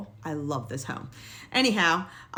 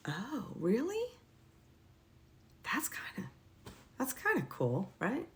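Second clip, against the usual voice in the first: half-whispered, much softer musing; hushed inner thought; 4 semitones higher, 12 dB quieter than usual. Retold or imitated. Thinking to herself about the guest, hushed. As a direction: breathe it like a private thought. Her own inner reaction, retold.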